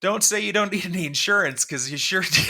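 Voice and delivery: high quote of the line; character voice. Retold or imitated. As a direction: lift the pitch for the quote. Quoting the insurance character's line.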